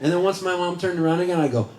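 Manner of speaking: ordinary talk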